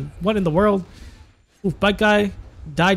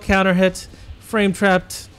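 usual speaking voice

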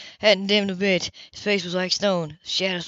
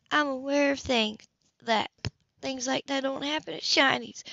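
female voice; 6 semitones higher than usual. Acted. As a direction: explain it to him, higher pitched. Grace voiced higher.

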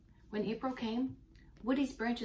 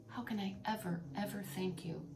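hushed, softer words of thanks; grateful tree speaking in a soft hush; 7 dB quieter than usual. A narrator's reading. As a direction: thank your rescuers from the heart. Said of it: "said Woody so gratefully".

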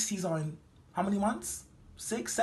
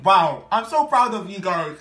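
praise; 9 dB louder than usual. Acted. Teacher praising the class.

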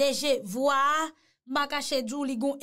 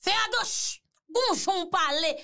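higher, firm commanding orders; authoritative boss; 5 semitones higher than usual. Shown as a demonstration. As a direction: bark orders like the one in charge. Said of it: "Ou santi otorite nan vokal la".

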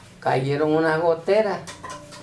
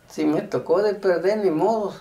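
ordinary talk, nothing marked

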